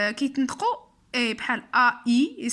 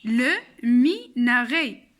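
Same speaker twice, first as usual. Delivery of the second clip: "لو ميناري" broken into slow syllables, ending in é; drawn-out, emphatic teacher's model; slow model word; 4 dB louder, slower than usual. Shown as a demonstration. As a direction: sound out "لو ميناري" piece by piece. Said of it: "في هاد الكلمة".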